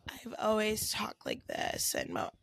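low, slow, whispery croak; changed-voice impression. Mocking Kim K's changed voice, low slow croak. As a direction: say it slowly, sinking into a soft croak. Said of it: "when Kim K changed her voice".

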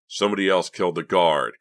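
loud, heated retort. A narrator's reading. Boy defends himself over the guard, heated. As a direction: throw it back with some heat. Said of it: "Clay said heatedly".

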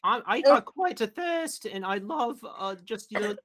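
put-on worst accent. Raccoon orders sludge at the slug's bar, hammed-up high voice. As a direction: play the raccoon in a deliberately terrible put-on voice. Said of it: "the worst accent that you'll just immediately kill this".

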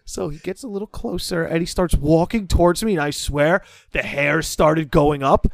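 ordinary talk

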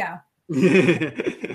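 drawn out through a laugh. Real, not acted. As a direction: laugh the words out slowly. Host laughs while wrapping up.

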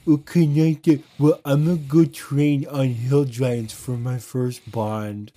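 put-on beggar voice, slow and drawn out; whiny begging. Free-to-play beggar buttering up a rich player, exaggerated pleading. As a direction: stretch the words into a pleading, fawning whine. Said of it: "Begging is".

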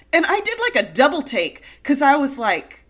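ordinary delivery

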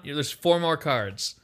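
normal talking voice

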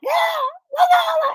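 piercing high wailing cry, far above his voice; dying dog wail. Retold or imitated. Imitating the dog after the van hit it, high loud wail. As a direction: wail like a hurt animal, loud and shrill. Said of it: "The thing was like".

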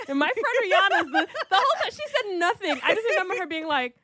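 high-pitched and laughing as she talks. Real, not acted. Recalling her friend's silence to the host, laughing. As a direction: talk through real laughter.

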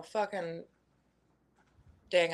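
normal talking voice

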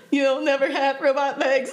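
laughing through a robot voice. Retold or imitated. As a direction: quote the line like a robot, cracking up. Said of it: "always talking in, like, a robot voice".

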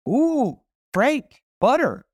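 high, thrilled diner wife; excited wife. Retold or imitated. Excited wife telling Frank about the butter, high and gushing. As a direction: coo like a delighted wife.

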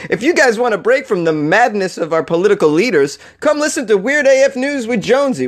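high-pitched, hammed-up radio advert; sing-song announcer pitch, played for laughs; mock show promo. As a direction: sell it like a cheesy station promo. Second mock promo for his show.

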